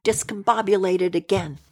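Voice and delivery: plain reading voice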